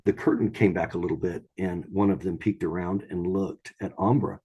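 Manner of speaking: ordinary delivery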